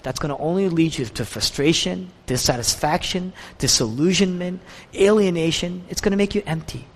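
ordinary talk

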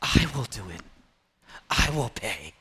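very low, soft solemn promise; grave, softened acted pledge; hushed vow. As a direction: drop right down into a quiet resolve. Acted vow after the urgent plea.

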